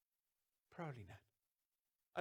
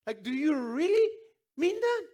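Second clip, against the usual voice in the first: incredulous; 8 semitones higher, 10 dB louder than usual. Acted. Incredulous reaction to sermon title.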